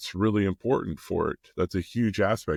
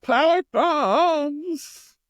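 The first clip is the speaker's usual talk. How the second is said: high, drawn-out wrestling holler; wrestling move call; 5 dB louder, much slower than usual. Acted. Dad joking about wrestling his child, hyped wrestling holler. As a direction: call it out long and high like a ring announcer.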